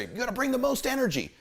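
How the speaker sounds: high-pitched, pumped-up quote; massive energy. Retold or imitated. Quoting hype people to listeners, massive energy. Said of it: "I brought all this massive energy".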